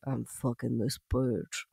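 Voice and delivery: low, slow, soft aside; Rasputin's aside. Retold or imitated. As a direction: drop it low and slow, as a private boast. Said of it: "and he was like".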